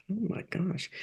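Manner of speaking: soft inner sigh of dismay. Retold or imitated. Hearing the senior rabbi forbids her, quiet dismay. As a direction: say it to yourself, deflated. And I thought.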